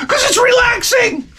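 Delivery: very high, squealed comic comeback; hammed-up exasperation, voice leaping way up; exasperated retort. Mock argument with wife.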